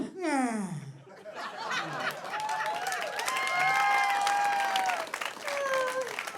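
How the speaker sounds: very high drawn-out wail; long high wail. Pretend. Comedian ending the Oz medley with a wail. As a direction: let out a long high cry. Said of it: "Wizard of Oz".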